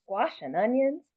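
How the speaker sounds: put-on lively read-aloud, lifting for the child's voice; story reading. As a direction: read it warmly to a class. Reads picture book aloud.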